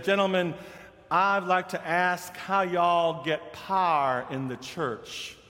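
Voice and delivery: light Southern drawl, slow; gentle mock Southern lady. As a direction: ask politely with a hint of Southern accent. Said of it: "she was from the South".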